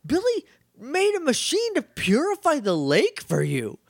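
high, incredulous comic rant; mock outrage. Viewer telling off the aliens who ignored Billy's machine, mock outrage. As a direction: scold them as if personally betrayed. Scolding characters over purified water.

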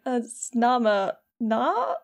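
slow, halting, questioning at the end; struggling to remember. Retold or imitated. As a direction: grope for the name syllable by syllable. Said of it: "clearly trying to remember a name".

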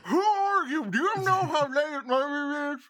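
put-on high, loud grumpy old-timer; old prospector. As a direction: scold the late visitors. NPC grumpy at door.

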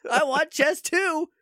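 high, whiny-indignant demand played for laughs; comic high-pitched protest; mock outrage. Comic tantrum about wanting a sequel.

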